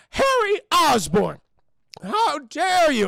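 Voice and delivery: mock indignant old lady; outraged aunt. Aunt scolding Harry, slow mock outrage.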